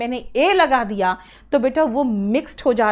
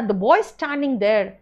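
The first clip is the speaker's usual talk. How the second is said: slow example reading; much slower than usual. Shown as a demonstration. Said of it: "कहा था ना".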